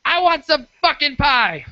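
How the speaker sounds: put-on high, slow whiny kid shriek; shrill-free, squealing brat demanding pie; bratty child yell. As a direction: throw a tantrum like a spoiled child. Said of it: "looks at his mom and goes".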